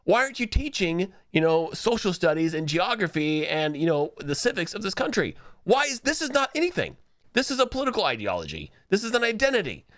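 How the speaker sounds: mother scolding the teacher, high mock outrage; outraged mother. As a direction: rant at the teacher in a high, shocked voice. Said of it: "She's like".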